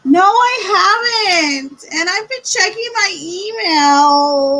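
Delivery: high, loud mock whine; whiny. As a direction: whine like a sulky kid. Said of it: "I sound like a big whiny butt".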